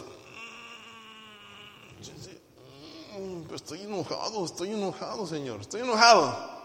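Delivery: grudging mumble, then blurting out the anger; sulky grumbling. Retold or imitated. Creyente reprendido por el Señor. (creyente enfurruñado ante el Señor, refunfuñando)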